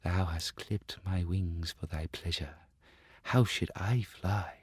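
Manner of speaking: very soft, reproachful bird voice; hurt linnet. Acted. Linnet reproaching the Star Child, very soft. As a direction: answer with quiet hurt.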